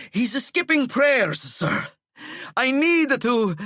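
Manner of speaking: barely enough breath to finish; breathless prefect reporting; panting slowly. Acted. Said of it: "stop your panting".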